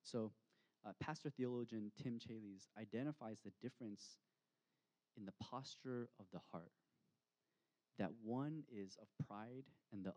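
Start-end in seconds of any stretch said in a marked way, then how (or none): none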